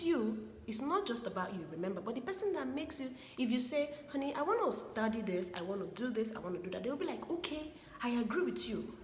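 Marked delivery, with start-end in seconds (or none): none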